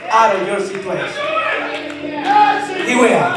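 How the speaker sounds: high, ringing, shouted preaching; full-force preaching. Real, not acted. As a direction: drive the promise home at the top of your range.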